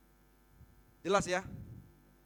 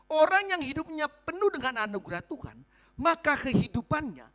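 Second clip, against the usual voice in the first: usual speaking voice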